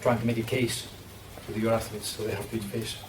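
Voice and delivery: ordinary delivery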